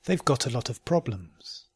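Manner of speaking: "They've got a lot of problems" run together quickly. Shown as a demonstration. Teacher repeating the practice sentence, quickly.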